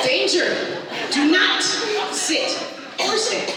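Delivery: loud, high emphatic warning; warning label. Acted. Comedian reciting the ladder's top-step warning, loud and emphatic. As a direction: belt it out word by word, high and loud. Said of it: "what it says".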